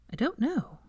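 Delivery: high, soft puzzled reply; genuinely baffled; puzzled Hermione. Acted. Hermione answering Veronica, soft high puzzlement.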